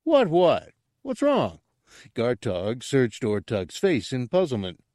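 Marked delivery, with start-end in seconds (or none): 0.0-1.6 high, loud, puzzled yelp; high-pitched flustered simpleton; startled confusion. Acted. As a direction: ask in alarm, pitched way up. Gartog puzzled by Ortug's outburst.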